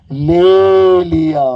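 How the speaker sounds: slow, loud, weighty ले लिया; preacher's emphatic proclamation; solemn drawn-out declaration. Shown as a demonstration. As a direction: let ले लिया land slowly and heavily. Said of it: "वो सही बोल रही थी".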